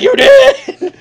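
very high, loud squeal through laughter; laughing. Retold or imitated. Girlfriend confirming he answered the phone in his sleep, laughing shriek. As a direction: shriek it while cracking up. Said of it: "And she's like".

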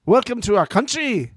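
lightly put-on South African accent. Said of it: "do the South African accent".

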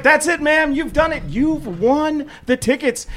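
high-pitched game-show excitement; prize announcement. Retold or imitated. DJ telling the caller she won the tickets, thrilled. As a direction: build to a drawn-out big reveal.

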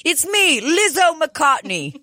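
high, drawn-out mock British; British accent. Co-host riffing on an English version of a singer, British accent. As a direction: announce yourself in playful fake British. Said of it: "you were doing a British accent".

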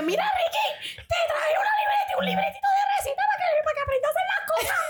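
mom's high voice, fast and laughing; imitating mom. Mom handing Ricky a recipe notebook, in a high mimicking voice. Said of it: "mami llega y te dice".

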